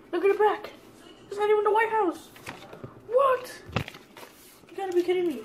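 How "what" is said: high, disbelieving cry; sudden high burst of disbelief; shocked what. Real, not acted. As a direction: jolt up in disbelief. Disbelief at fake bill.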